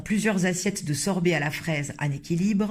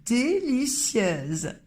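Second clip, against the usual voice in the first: higher, very drawn-out mocking délicieuse; mock old lady; 6 semitones higher, much slower than usual. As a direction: stretch the word like a fussy old dame. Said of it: "elle m'a répondu".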